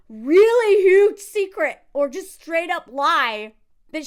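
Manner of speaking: drawn-out loud stress on really huge secret; heavy emphasis. Real, not acted. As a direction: lean hard into every word. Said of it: "stressed emphasis".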